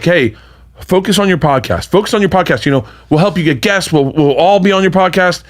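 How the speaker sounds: eager encouragement. Retold or imitated. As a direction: hype him up like a coach. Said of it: "I remember them being like".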